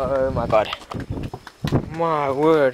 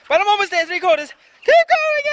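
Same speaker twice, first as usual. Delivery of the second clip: high, louder, urging herself on; excited self-encouragement; 12 semitones higher, 4 dB louder, faster than usual. Real, not acted. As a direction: psych yourself up. Pushing herself across bridge.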